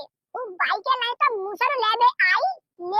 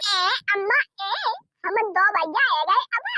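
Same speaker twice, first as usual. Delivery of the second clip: ordinary delivery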